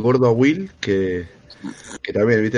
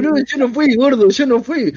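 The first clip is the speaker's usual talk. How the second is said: much higher, desperate protest; pleading denial; 13 semitones higher, 4 dB louder than usual. Retold or imitated. Accused boy pleading innocence to bully, high mock desperation. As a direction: beg to be believed, mock panic.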